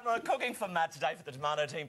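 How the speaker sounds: ordinary talk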